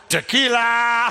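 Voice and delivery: slow, loud, drawn-out call. Pretend. Wife shouting [name] to her husband, loud and slow. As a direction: belt it like the song. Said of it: "she just goes".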